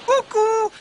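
very high, loud, teasing call; Peter's own voice. Acted. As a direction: sing out mockingly like a cheeky boy. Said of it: "rispose Peter con la sua voce".

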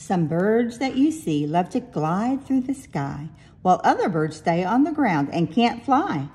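ordinary delivery, nothing marked

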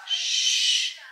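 shushing. Real, not acted. Hushing someone.

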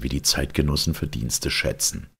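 ordinary delivery

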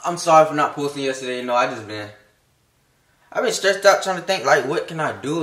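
ordinary talk

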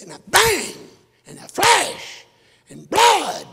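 high, loud shouted climax; preacher shouting the suicide scene to the crowd; dramatic outcry. A narrator's reading. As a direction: hit each word like a gunshot.

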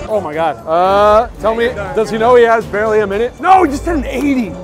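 usual speaking voice

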